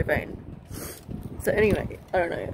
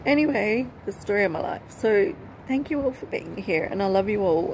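ordinary talk

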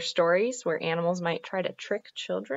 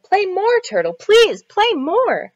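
put-on high, loud child pleading for more; eager begging; 13 dB louder, much slower than usual. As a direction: beg excitedly, voice way up. Child begs Turtle to play.

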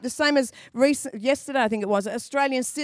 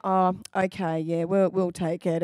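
lower, soft, feigned indifference; casually playing it down; 6 semitones lower, 3 dB quieter than usual. Couple pretending to shrug off the huge suite, low and casual. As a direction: accept as if it's no big deal. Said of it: "play it down".

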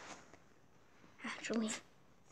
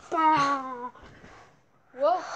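ordinary talk

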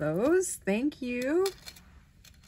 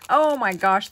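excited; 12 dB louder than usual. Real, not acted. Delighted exclamation.

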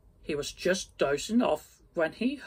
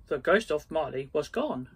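plain reading voice

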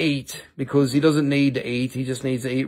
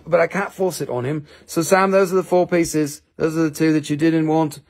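ordinary talk, nothing marked

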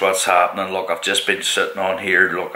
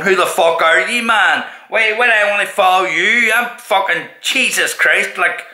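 mock challenge; 8 semitones higher than usual. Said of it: "He keeps popping up on my YouTubes and going".

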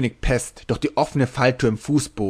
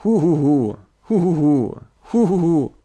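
a higher, louder giggling hu hu hu; drunken giggling fit; 5 semitones higher, 6 dB louder than usual. Acted. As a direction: giggle on and on, rising. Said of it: "kicherte Tarpaulin".

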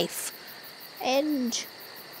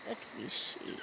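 hushed thinking aloud; whispered aside; 11 semitones lower, 13 dB quieter, faster than usual. Acted. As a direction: whisper to yourself.

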